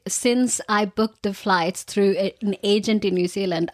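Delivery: ordinary delivery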